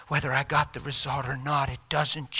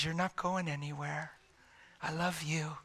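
retold gentle comfort; tender reassurance; 8 dB quieter than usual. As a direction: hush and soothe someone crying. Reassuring crying wife, retold.

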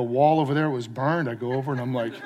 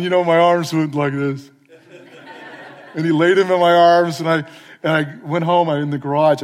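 ordinary talk, nothing marked

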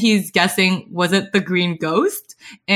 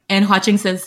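usual speaking voice

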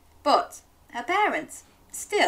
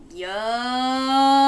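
drawn-out yummy, higher; delighted drawn yummy; 4 semitones higher, 5 dB louder than usual. Shown as a demonstration. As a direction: savour the word. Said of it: "can you say yummy with me".

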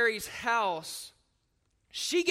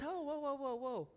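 soothing whoa; 2 semitones higher, 10 dB quieter than usual. Acted. Jesus calming the crowd.

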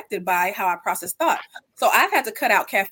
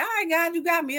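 higher, easygoing acted agreement; breezy prayer; 8 semitones higher than usual. Woman in her twenties telling God it's easy, breezy. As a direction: lightly toss it up to God.

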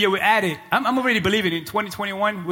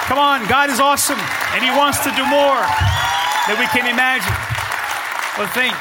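loud, raised preacher's delivery; impassioned preaching; 5 semitones higher, 3 dB louder than usual. Real, not acted. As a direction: preach in short, forceful bursts with pauses. Preaches at full force.

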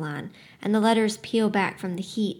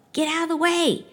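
a higher, raised voice from the crowd; yelled warning; 8 semitones higher than usual. A narrator's reading. Someone in the crowd yelling a warning, raised voice. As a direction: shout the warning over the noise. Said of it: "Someone yells".

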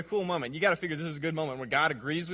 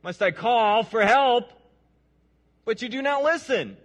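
loud, high anguished appeal to God; raised-voice prophet's lament; Habakkuk crying out; 7 semitones higher, 8 dB louder than usual. Acted. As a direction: cry out in complaint, voice raised high. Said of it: "this is Habakkuk speaking".

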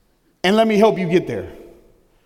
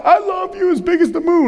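little daughter's voice; 10 semitones higher than usual. Retold or imitated. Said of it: "She said".

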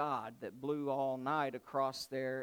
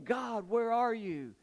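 higher, drawn-out plea to God; mild acted shout, reaching for God; calling out; 7 semitones higher, 3 dB louder, slower than usual. Preacher as sleepless psalmist calls to God, pleading cry. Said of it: "I'm shouting to you".

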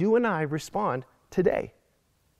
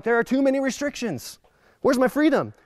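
higher, whiny mock protester; mock freedom protester; 6 semitones higher than usual. Pastor mimics a churchgoer complaining about restrictions, higher and whiny. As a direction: lightly play the freedom complainer. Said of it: "other people are like".

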